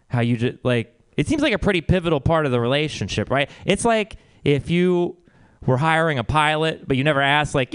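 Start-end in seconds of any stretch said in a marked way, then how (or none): none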